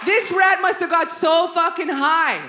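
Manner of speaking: loud, slow, high punchline; slow, loud disbelieving punch line; emphatic. Real, not acted. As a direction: lean hard on every word.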